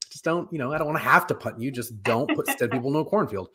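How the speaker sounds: ordinary talk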